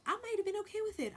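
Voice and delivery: "I might have been okay with it" squeaked up high and quick. Shown as a demonstration. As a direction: jump way up in pitch and rush it.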